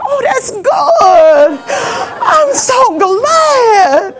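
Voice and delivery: high, very drawn-out fake gladness, nearly crying; hammed-up strained delight hiding panic; forced niceness. Mother stays cool with son's girlfriend, forced niceness. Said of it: "I gotta stay nice".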